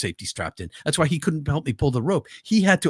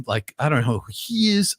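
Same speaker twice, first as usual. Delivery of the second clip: usual speaking voice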